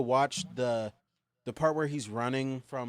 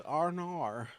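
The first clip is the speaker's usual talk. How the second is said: Australian twang on R and R; Australian accent; 4 semitones higher, slower than usual. Shown as a demonstration. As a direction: say it like an Aussie. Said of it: "that's why I can do an Australian accent".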